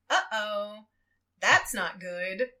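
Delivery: lightly voiced robot, drawn out; a hint of slow animatronic menace; metallic voice. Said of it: "metallic-y voice go".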